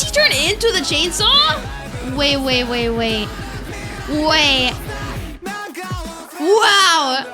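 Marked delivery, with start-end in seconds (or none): none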